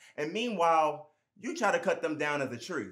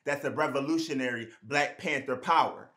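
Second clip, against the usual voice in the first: normal talking voice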